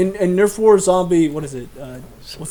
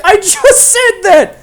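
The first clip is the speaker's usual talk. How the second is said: annoyed; 12 semitones higher, 5 dB louder, much slower than usual. Real, not acted. Annoyed repeat to friend.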